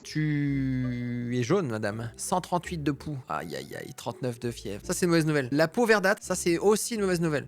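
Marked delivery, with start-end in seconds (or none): 0.0-2.1 slow deadpan. Acted. Slow deadpan verdict on yellow skin. 3.3-3.9 very low, soft dismayed groan. Acted.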